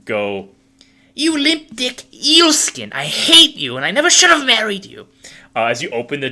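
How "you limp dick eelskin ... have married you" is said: high, loud marital tirade; screeching spouse. Acted. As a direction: hurl it in a screechy character voice. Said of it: "another voice go".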